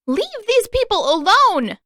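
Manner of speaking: high, loud desperate shout. A narrator's reading. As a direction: yell at the spirit to stop. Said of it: "she shouted".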